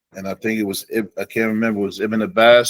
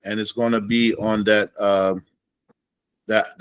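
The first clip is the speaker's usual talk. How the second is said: ordinary delivery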